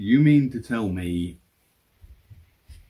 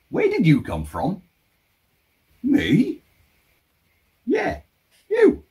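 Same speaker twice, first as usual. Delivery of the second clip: arguing with myself; 10 semitones higher than usual. Acted. Vlogger voicing several selves.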